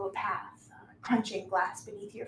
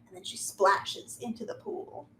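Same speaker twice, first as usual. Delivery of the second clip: ordinary talk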